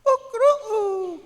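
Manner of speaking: high, loud mock crowing; imitated rooster crow. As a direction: crow like a teasing classmate mimicking a rooster. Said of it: "nagkarkaraok da". (tuladen ti karaok ti kawitan)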